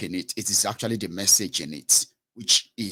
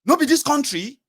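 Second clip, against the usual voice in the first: high, loud pidgin outburst; angry businessman; 6 dB louder than usual. Retold or imitated. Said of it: "he was turned around and said".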